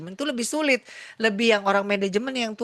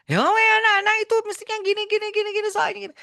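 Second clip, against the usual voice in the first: much higher, louder, fast indignant ranting; irritated doctor; 6 dB louder, much faster than usual. Retold or imitated. Angry doctor explaining himself to the nurse, indignant and loud. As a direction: rant back, defensive and annoyed.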